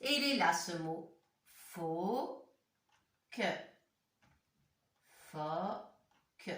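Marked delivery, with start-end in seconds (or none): 1.7-3.4 "Phoque" as a long rising "fo", a pause, then "que"; syllable-by-syllable reading. Shown as a demonstration. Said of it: "Essayez bien de lire les mots". 5.3-6.5 "Phoque" with a long open o, then a detached "que". Shown as a demonstration. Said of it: "C'est un O ouvert".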